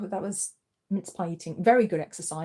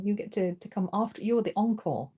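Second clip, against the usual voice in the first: ordinary talk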